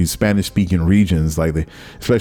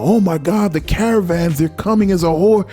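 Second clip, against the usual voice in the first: much higher, panicky mock voice; mock alarmist propaganda; 10 semitones higher than usual. Border propaganda about the caravans coming, mock panic. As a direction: imitate scaremongers raising a false alarm. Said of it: "the propaganda was going on".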